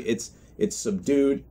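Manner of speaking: ordinary talk, nothing marked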